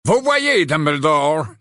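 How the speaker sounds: high, drawn-out mocking needling; sly, lofty jab at the headmaster; malicious. A narrator's reading. Phineas Nigellus needling Dumbledore about the young, malicious. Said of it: "dit Phineas Nigellus d'un air malicieux".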